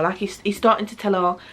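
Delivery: normal talking voice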